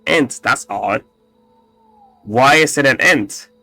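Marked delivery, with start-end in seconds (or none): none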